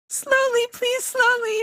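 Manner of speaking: very high, sing-out mock plea; pleading. Driver begging mechanics to slow down, high retold plea. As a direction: beg in a high, helpless retold voice.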